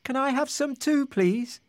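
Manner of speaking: tiny, high-pitched crab; high little storybook crab. A narrator's reading. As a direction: ask politely in a small, high creature voice. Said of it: "they heard a little voice".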